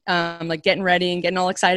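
usual speaking voice

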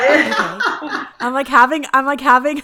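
giggly disbelief, breaking into laughter; laughing. Real, not acted. Laughing at being called Southern.

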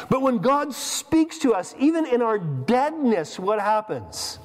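high, slow, drawn-out rhetorical questions; preaching build. Real, not acted. Preacher asking the church about death, slow and intense. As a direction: pull them in, question by question.

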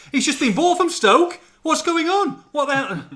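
high, loud mock fury, a bit slow; hammed-up furious footballer raging at him; imitating an irate ex-player shouting; furious retold rant. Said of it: "absolutely furious".

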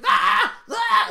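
high, loud screaming Mario; hammed-up terrified shriek; mock shriek. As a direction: shriek like falling through a painting. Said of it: "he just shrieks".

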